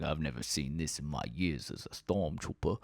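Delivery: low, soft put-on voice; lightly voiced gruff old trooper; odd Jude Law voice. Jude Law's character marvelling, low soft put-on voice. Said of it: "I don't know why he's talking like that".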